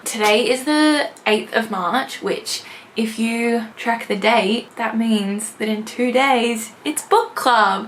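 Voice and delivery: slow, upbeat host greeting to camera; cheerful bookish channel opener; vlog intro. Acted. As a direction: welcome the viewers in, unhurried.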